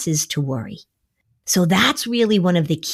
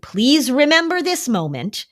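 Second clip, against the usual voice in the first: ordinary delivery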